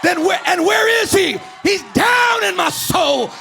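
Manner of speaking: high, loud shouting that keeps climbing; full-force preaching. Real, not acted. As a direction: declare freedom at the top of your voice.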